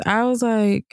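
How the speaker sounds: ordinary delivery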